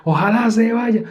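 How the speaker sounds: high, slow mock tantrum; hammed-up angry neighbor. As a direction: whine it like an offended neighbor. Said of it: "así diciendo".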